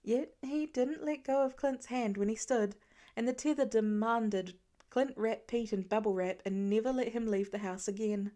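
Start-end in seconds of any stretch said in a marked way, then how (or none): none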